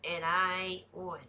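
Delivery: ordinary talk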